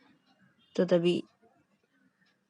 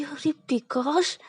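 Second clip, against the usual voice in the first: panicked whisper; 8 semitones higher, much slower than usual. Acted.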